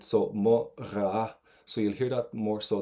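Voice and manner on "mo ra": "ra" with a throaty R-like GH at the back; slow, louder "mo ra", rasped in the back of the throat. Shown as a demonstration. Teacher shows Leisha the GH, throaty "ra". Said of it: "it'll come in the back of your throat".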